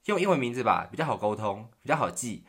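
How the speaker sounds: insistent suggestion. Retold or imitated. Retold classmate's insistent suggestion.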